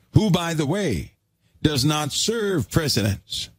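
a preacher lifting his voice at the flock; fervent preaching. Acted. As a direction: drive it home from the pulpit. Preaching at full force.

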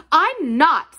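high, loud, snappish denial; snapped. A narrator's reading. Colin denying he is the selfish one, snapping. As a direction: snap back indignantly. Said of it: "snapped Colin".

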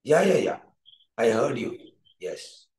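ordinary talk, nothing marked

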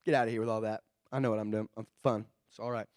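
low careless scoff; dismissive fool. Retold or imitated. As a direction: wave it away like a fool. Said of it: "they will ignore you".

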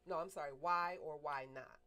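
normal talking voice

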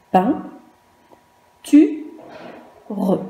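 pain, tu, re, séparés par de nettes pauses; énoncer chaque syllabe seule, tu plus aigu; syllabes isolées. Shown as a demonstration. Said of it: "La première syllabe". (pain... tu... re, le « re » final bien prononcé)

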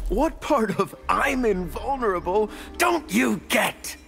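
high-pitched, impatient challenge; exasperated boast. Acted. Exasperated retort to opponent.

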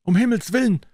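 distressed daughter crying out from the corridor; hoarse girl's voice. Acted. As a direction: cry out in despair as the young daughter. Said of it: "eine heisere Stimme von draußen".

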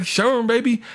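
high, incredulous put-on voice; mock-amazed character voice; retold comic dealer. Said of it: "he's like".

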